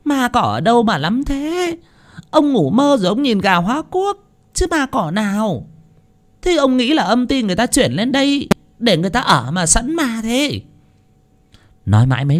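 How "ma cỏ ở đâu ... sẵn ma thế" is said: high-pitched angry scolding; scornful, high-voiced telling-off; exasperated wife. A narrator's reading. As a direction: nag him crossly in a high voice. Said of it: "Mụ Xuân cáu tiết".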